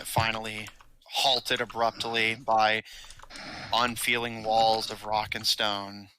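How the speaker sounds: ordinary talk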